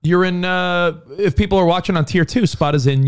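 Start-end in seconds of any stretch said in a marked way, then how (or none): none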